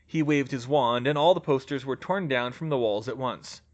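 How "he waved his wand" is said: ordinary delivery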